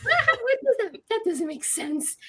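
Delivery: very high-pitched, stammered bafflement; baffled disbelief. Retold or imitated. Patient told the consult is free, baffled disbelief. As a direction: squeal it out, utterly thrown.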